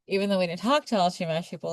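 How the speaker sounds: ordinary talk, nothing marked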